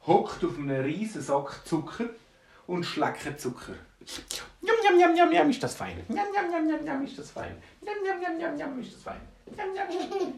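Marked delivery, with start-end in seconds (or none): 4.6-10.3 very high, squeaky little gnome; munching gnome. Acted. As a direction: chant it greedily while smacking on sugar. Gnome greedily licking sugar, voiced.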